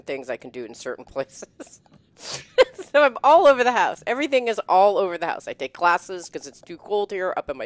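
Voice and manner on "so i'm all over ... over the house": high, loud, fast laughing rush; laughing exclaim. Real, not acted. Host laughing about her scattered workspaces, loud and quick. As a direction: burst out laughing about the mess.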